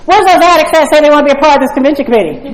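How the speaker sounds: imitating my old impatient self; indignant complaining. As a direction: gripe at the empty room, half-mocking yourself. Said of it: "I'd say".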